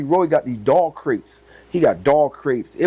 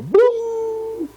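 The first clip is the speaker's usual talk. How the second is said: slow, soaring "boom"; drawn-out high boom; light bulb moment. Real, not acted. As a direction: stretch the boom up high like a revelation.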